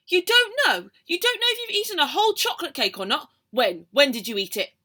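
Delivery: loud, high exasperated scold. Acted. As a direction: scold the greedy child. Mum scolding.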